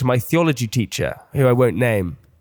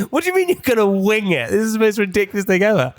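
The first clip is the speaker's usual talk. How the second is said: laughing disbelief; 6 semitones higher than usual. Retold or imitated. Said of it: "I was like".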